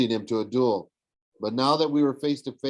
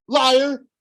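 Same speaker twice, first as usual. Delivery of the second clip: much higher, loud furious shout; 8 dB louder than usual. A narrator's reading. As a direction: scream the word at him. Annabeth shouts at Luke.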